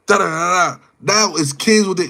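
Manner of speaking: ordinary talk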